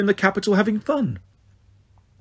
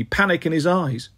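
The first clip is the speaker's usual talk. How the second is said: ordinary delivery, nothing marked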